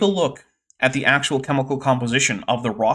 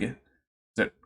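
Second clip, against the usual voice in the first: normal talking voice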